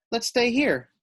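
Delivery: put-on high, bright child's plea; high little girl voice; eager kid. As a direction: coax a friend to stay. Maddie wants to keep climbing.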